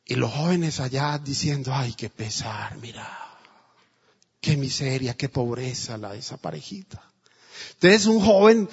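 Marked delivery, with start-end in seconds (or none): 1.7-7.0 low hushed whisper, almost no voice; whispered pity. Retold or imitated. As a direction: murmur the pity so the couple won't hear. Youths pitying the old couple. (susurro compasivo de los jóvenes)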